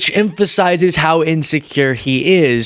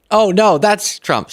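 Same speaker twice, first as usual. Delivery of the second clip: feigned surprise; 9 semitones higher, slower than usual. Pretend. Feigned realization in sarcastic bit.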